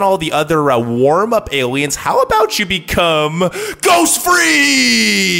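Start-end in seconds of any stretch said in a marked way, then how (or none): 3.8-5.4 dramatic name call. Acted. Announcing alien name.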